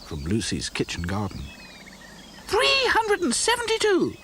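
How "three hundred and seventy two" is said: high, loud triumphant call. A narrator's reading. Dotty announcing her count to the others, calling out high. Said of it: "called Dotty at last".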